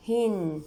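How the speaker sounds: هن as "hin" with a held n; tanween kasra. Shown as a demonstration. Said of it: "مع تنوين الكسرة". (تنوين الكسر)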